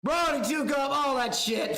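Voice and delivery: ordinary delivery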